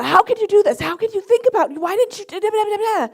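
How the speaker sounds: high, loud scolding tirade; hammed-up angry rant dissolving into blah-blah; berating. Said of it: "berating Lisa".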